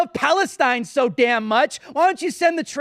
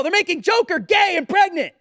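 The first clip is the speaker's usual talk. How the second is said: outraged shout; 6 semitones higher than usual. Real, not acted. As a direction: yell it in disbelief. Outraged rant peak.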